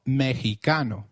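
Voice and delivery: Mexicano said "meh-hee-KAH-no"; Spanish Mexicano, h in place of ks. Shown as a demonstration. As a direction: model Mexicano with a throaty h for the X. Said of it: "can you try saying Mexicano".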